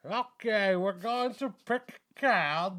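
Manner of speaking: high, loud showman magician patter; theatrical magician. Pretend. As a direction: play a grand stage magician. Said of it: "give me a magician voice".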